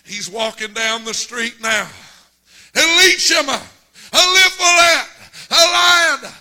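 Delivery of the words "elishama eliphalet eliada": loud high shouted names; calling out. Retold or imitated. Father searching the street for his boys, loud shout.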